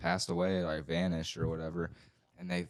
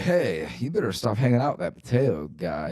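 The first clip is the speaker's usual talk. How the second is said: imitating a scolding mom; 4 semitones higher, 7 dB louder than usual.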